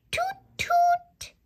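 high breathy toot toot like a train; whispered high train toot; train whistle. Acted. Train whistle sound in story.

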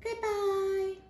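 high, light little-dog voice; a squeaky cartoon dog saying goodbye. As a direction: pipe it up high like a small dog. Dog says goodbye again.

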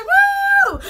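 high whoop of joy; cheer. Real, not acted. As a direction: whoop it out for the birthday. Cheering about her birthday.

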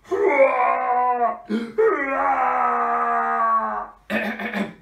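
put-on loud, high baby bawl; mock baby crying. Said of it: "cry like a baby superhero".

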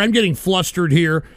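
normal talking voice

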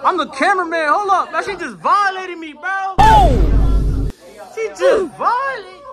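high, worked-up outcry; outraged protest. Real, not acted. Cameraman says she just violated him, high outraged protest.

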